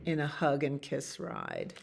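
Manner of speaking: usual speaking voice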